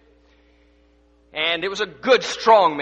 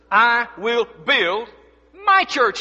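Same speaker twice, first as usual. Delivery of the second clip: "I will build" drawn out slowly, then "my" punched high; stress on MY; 5 semitones higher, 8 dB louder, much slower than usual. Shown as a demonstration. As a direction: hit "my" high after a slow lead-in. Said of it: "Notice the emphasis on the pronoun my".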